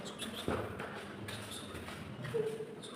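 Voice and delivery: sharp hushing whisper. Real, not acted. Hushing while hiding.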